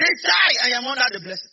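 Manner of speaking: high, shouted proclamation; big outburst opening into a loud declaration; exclaimed declaration. Real, not acted. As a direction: shout it out for the congregation to echo. Leads congregation in exclaimed declaration.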